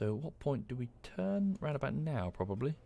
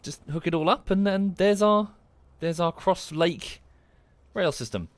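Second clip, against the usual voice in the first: proud reveal; 6 semitones higher, 7 dB louder than usual. Real, not acted. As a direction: present it with a flourish.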